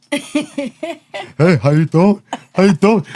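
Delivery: high, goofy friendly greeting; friendly dog voice. Retold or imitated. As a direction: play the sweet German shepherd. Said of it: "they're like".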